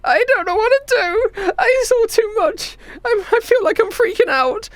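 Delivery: put-on high-pitched tearful kid; little kid freaking out; overwhelmed child. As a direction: whimper and falter in a high child's voice.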